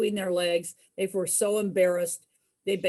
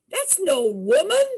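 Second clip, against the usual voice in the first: a touch of alarm, higher and louder; startled realization; 6 semitones higher, 6 dB louder than usual. Retold or imitated. Cousins realize it's not woman.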